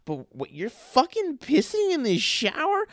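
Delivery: put-on incredulous girlfriend, stretched and high; imitating Zoe. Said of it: "She's like".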